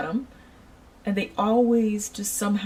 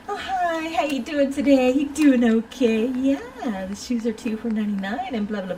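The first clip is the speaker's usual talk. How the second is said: higher, overly sugary shop-clerk greeting; sickly sweet; 5 semitones higher, 3 dB louder than usual. Retold or imitated. Clerk greeting a rude customer, sickly sweet and higher. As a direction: pour on fake customer-service sweetness. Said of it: "you know, sickly sweet".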